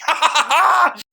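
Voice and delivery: sudden high burst of laughter. Real, not acted. Laughing about the FBI hanging up on him.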